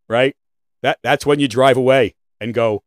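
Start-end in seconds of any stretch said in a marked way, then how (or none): none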